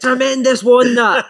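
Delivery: high, loud, slow cheer; hearty praise. Retold or imitated. Coach cheering a youth player at training, loud and high. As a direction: boom the praise across the pitch.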